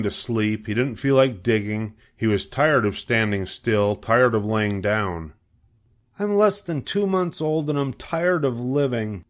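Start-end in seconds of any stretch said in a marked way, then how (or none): 6.2-9.2 high, world-weary piglet voice; bored young pig. A narrator's reading. Young pig tired of living, high and weary. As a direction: pitch it up for a little pig.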